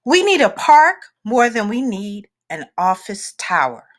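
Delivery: usual speaking voice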